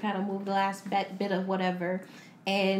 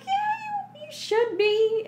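high mocking voice; slower than usual. Mocking the wife's reply.

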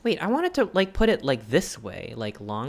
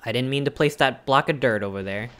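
ordinary talk, nothing marked

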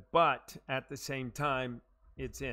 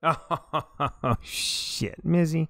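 excited laugh; 3 semitones higher, 9 dB louder, much slower than usual. Real, not acted. Friend's shot goes astray.

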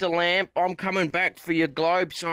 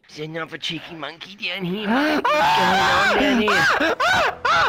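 high startled yell; slower than usual. Acted. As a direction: bellow it in shrill alarm. Character finds monkeys, yells.

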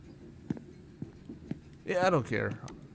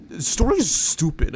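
high, louder mock sneer at the story; mocking critic; 7 semitones higher, 9 dB louder than usual. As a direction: scoff like a snobby reviewer. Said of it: "was like".

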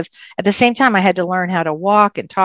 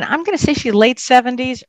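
normal talking voice

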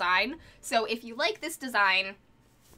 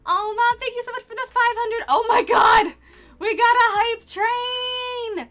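excited; 10 semitones higher, 7 dB louder, slower than usual. Real, not acted. Streamer excited by big donation.

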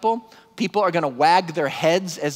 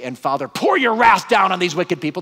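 loud, raised-pitch call for divine wrath; mock wrathful prayer; 5 semitones higher, 6 dB louder than usual. Preacher voicing the prayer Jesus doesn't say, mock wrathful. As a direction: thunder out an acted plea for punishment.

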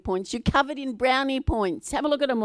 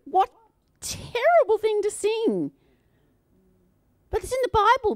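mock dismay; 11 semitones higher, 5 dB louder than usual. Mock outrage, high voice.

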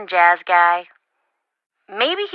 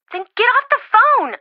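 urgent yell; 13 semitones higher, 5 dB louder than usual. Acted. Friend urging Nancy in danger.

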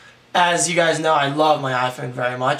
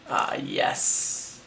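hushed delight; 6 dB quieter than usual. Real, not acted. Unwrapping the phone, hushed.

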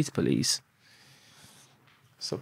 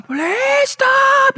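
way higher and louder, mock police yell; police shout; 8 dB louder, much slower than usual. Mimicking the police chasing them, a high loud yell. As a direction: bellow it like a chasing copper. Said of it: "the noise that make when they're running".

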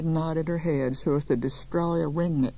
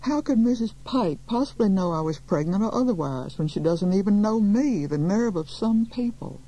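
higher, rising outrage; mildly outraged, emphatic complaint; indignant; 6 semitones higher, 4 dB louder than usual. A narrator's reading. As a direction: protest in affronted disbelief. Outraged reaction to the gossip.

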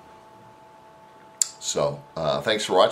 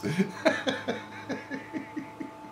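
laughing; 7 dB quieter than usual. Real, not acted. Laughing recalling wife.

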